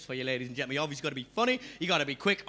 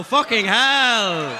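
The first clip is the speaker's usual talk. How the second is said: loud exclamation; 10 dB louder, much slower than usual. Real, not acted. Comedian reacts to crowd answer.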